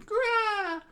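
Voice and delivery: high beast roar; monster screech. Acted. Lupin swiping its claws, with a screeching roar. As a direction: screech like an angry snow creature.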